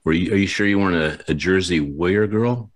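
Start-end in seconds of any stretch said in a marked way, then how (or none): none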